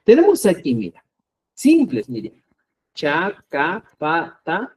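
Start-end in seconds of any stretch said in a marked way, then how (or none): 2.9-4.7 "Chacapataja" spoken slowly, each syllable clear, no puff of air on the stops; plain chacapataja. Shown as a demonstration. Teacher slowly shows the simple stops to his class. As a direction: say "Chacapataja" slowly with clean unaspirated ch, k, p, t. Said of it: "Simple, no hay mucha, no genera fricción".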